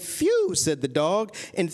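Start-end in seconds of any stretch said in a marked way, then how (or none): 0.0-0.5 high-pitched exhale of relief; relieved phew. A narrator's reading. Dog relieved the dragon has gone, high relieved phew. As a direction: let out a big phew.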